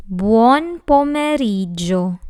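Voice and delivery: "Buon pomeriggio" said slowly, bwon po-meh-REE-jo; careful Italian phrase. Shown as a demonstration. Teacher models buon pomeriggio whole, slow and careful. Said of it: "This word has five syllables".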